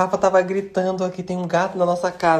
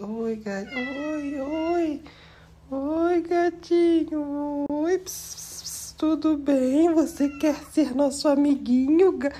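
much higher sing-song voice for the cat; 9 semitones higher, much slower than usual. Real, not acted. Talking to a stray cat in the house, high baby voice. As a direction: coax the cat with a high sweet voice.

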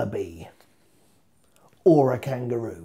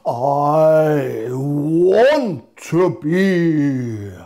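dramatic cry; 5 dB louder, much slower than usual. Acted. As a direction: wail it like a grand finale. Dramatic finish, shouted cry.

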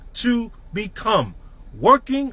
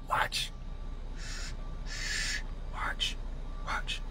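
breathy hushed watch; a near-silent ominous whisper; whispered warning; 17 dB quieter, much slower than usual. Acted. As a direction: lean in and whisper it like a threat.

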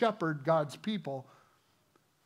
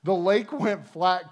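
ordinary talk, nothing marked

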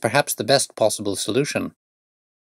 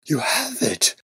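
breathless, higher exclamation; gasping in relief; 7 semitones higher, slower than usual. A narrator's reading. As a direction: gasp it out in disbelief. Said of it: "he gasped".

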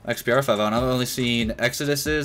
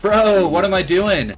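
high, loud disbelief; hyped at himself; excited; 7 semitones higher, 5 dB louder, slower than usual. Real, not acted. Reacting to his play.

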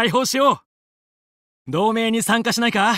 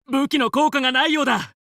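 ordinary talk

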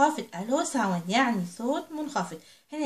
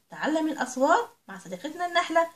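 ordinary talk

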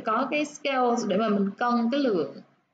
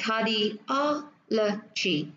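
cardiology slowly, stress on the OL; teacher's slow cardiology, car-di-OL-o-gy; stress on third syllable; much slower than usual. Shown as a demonstration. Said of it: "âm tiết mà nó nhấn vào sẽ là âm tiết thứ ba".

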